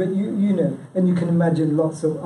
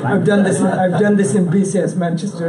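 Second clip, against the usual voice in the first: excited; 3 semitones higher, 7 dB louder than usual. Real, not acted. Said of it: "laughing excited aside".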